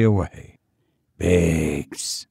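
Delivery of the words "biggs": low, lightly voiced commanding call; low-pitched, mildly authoritative summons; commanding sick master. A narrator's reading. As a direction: summon the servant with a touch of command. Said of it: "still strong and commanding".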